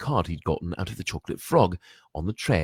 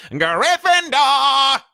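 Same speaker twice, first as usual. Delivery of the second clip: higher, louder, slow ringing call; hat's shout; 10 dB louder, much slower than usual. Acted. The hat shouting the verdict to the hall, loud call. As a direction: announce it to the whole hall. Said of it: "heard the hat shout the last word".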